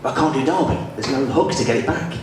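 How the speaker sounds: normal talking voice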